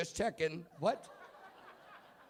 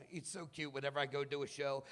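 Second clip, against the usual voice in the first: ordinary talk, nothing marked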